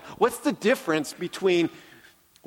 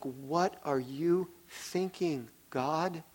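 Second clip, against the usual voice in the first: much softer, slow, as if alone with God; soft bewildered prayer; 8 dB quieter, slower than usual. Retold or imitated. On a long walk, questioning God, softly. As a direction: murmur it, baffled. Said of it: "It's like".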